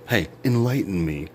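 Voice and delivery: usual speaking voice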